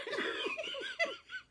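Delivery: very high breathless giggle; real shrieking laughter; squealing laugh. Real, not acted. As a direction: lose it completely. Laughing at the punchline.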